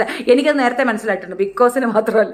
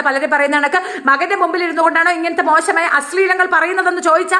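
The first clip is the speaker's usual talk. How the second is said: high-pitched, relentless scolding; heated, raised-pitch tirade; indignant rant; 5 semitones higher than usual. Real, not acted. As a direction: berate the fans in one long outburst. Rant scolding fans and contestants.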